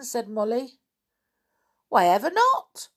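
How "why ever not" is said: high, loud, cheery pixie question; merry little voice. Acted. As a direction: pipe up merrily. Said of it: "said a merry little voice".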